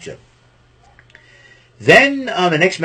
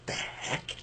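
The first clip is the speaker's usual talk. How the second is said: whispered disbelief; 19 dB quieter than usual. Retold or imitated. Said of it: "looks at Raven and go".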